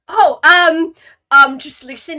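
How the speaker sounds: surprised wife. Retold or imitated. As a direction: blurt it out, caught off guard. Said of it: "And she was like".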